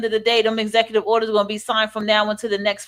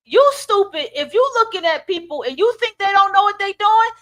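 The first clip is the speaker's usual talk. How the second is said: high-pitched, scolding challenge; indignant rant; 8 semitones higher than usual. Real, not acted. Rebuking those who excuse knowing wrongdoers, indignant rant.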